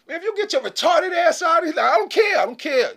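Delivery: loud, high, indignant tirade; exasperated shout. Acted. Dismissing someone who thinks they're a lady, exasperated shouting. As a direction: throw them out at the top of the range. Acted angry rejection of pretender.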